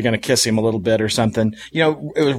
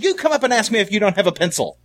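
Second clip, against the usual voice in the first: higher, laughing mock teacher; teacher insisting, half laughing; a pitched-up teacher telling off the kid; teacher correcting; 9 semitones higher than usual. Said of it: "She goes".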